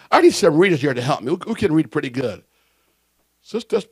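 ordinary talk, nothing marked

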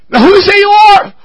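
voicing blind Isaac. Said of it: "Isaac questioning".

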